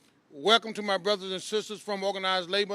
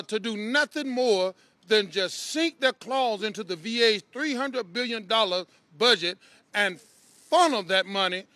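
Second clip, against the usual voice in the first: normal talking voice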